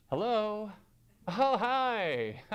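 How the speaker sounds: a hint of a soft, polite phone greeting; sudden sweet phone voice. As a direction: switch to a nice phone voice. Answering phone sweetly mid-argument.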